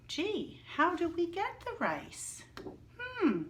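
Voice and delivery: high, wondering voice; teacher pretending not to know; mock puzzled. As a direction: ham up being stumped for the class.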